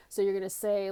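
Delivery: normal talking voice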